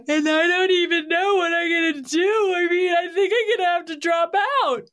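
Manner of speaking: high, wailing imitation of a distraught daughter; mock panicked daughter. Mother imitating her panicking daughter's call.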